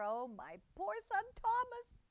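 high wailing exclamation; wailing cat mother. A narrator's reading. Tabitha despairs for her son Thomas, high wail. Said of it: "exclaimed Tabitha, wringing her paws".

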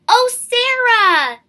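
high, loud, slow gush. Acted. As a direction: pour it on, sugary and high. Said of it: "Miss Burton gushed".

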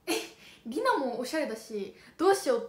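loud, rising panic, slowing down; panicked inner thought. Retold or imitated. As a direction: blurt out a flustered what-do-I-do. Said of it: "と思って".

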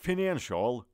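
normal talking voice

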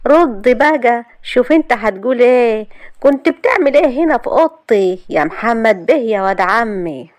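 high, loud mocking dare; mocking her cousin in front of his mother; sarcastic. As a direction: taunt him sarcastically. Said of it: "وقالت له ماسة بسخرية".

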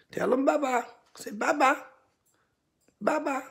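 pet teaching voice. Real, not acted. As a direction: coax the bird to repeat it. Teaching bird to say bye-bye.

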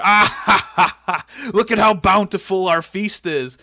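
loud, high, hammed-up boss laugh; mock maniacal laughing. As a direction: imitate a gloating laugh and keep crowing loudly. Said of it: "And he's just laughing".

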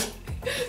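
squeaky high giggle. Real, not acted. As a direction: giggle at own weakness. Joking about losing money.

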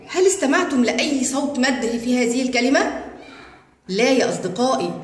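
usual speaking voice

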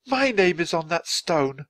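high-pitched dismay; horrified cry. A narrator's reading. Man reading his own tombstone, horrified. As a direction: cry it out in dawning horror. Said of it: "he cried".

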